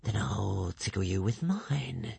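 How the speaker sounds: slow, soft serpent menace; lazy threatening serpent voice; hissing serpent dragon. A narrator's reading. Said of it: "said the creature".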